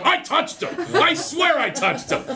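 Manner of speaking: high, slow whiny protest; high pleading boy; pleading kid. Retold or imitated. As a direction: insist like a kid caught out. Kid pleading he touched him.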